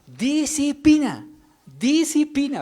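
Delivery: put-on high, slow little-girl preacher voice; squeaky child sermon, drawn out; child preaching. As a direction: mimic a five-year-old preaching with gusto. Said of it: "empezó como si estuviera predicando".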